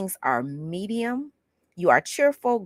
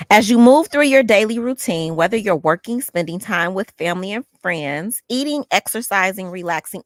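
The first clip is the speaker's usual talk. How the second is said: louder, deliberate book-reading voice; reading aloud from a book; 4 dB louder than usual. Acted. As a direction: read the passage out to viewers. Reads book passage aloud.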